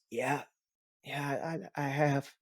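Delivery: put-on weak, halting words of a dying boy; a son fading, barely able to talk; struggling to speak. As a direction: push each word out with effort. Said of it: "he starts to have issues speaking".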